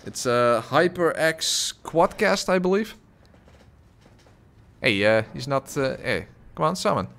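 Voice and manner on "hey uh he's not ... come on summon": ordinary delivery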